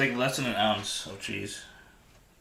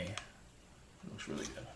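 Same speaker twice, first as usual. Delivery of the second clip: whispering; 6 semitones lower, 16 dB quieter than usual. Real, not acted. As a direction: drop to a whisper to yourself. Quiet aside while eating on stream.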